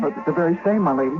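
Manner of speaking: put-on high, nervous young shepherd voice stumbling over words; frightened shepherd boy. Shepherd boy nervously answering the lady at night. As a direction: sound like a jumpy farm boy caught out at night.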